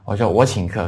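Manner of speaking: ordinary talk, nothing marked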